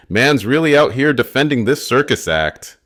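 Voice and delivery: high, loud mocking jeer; sneering. A narrator's reading. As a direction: sneer it out for the crowd. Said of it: "Tommy sneers".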